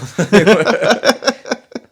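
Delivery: loud, high-pitched laugh breaking out; high burst of laughter. Real, not acted. Laughing hard at the name mix-up, high and loud. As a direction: crack up at the mix-up.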